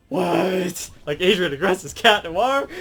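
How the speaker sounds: loud, high, stunned disbelief; acted shock. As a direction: blurt it out, imitating total astonishment. Acts out shock at reveal.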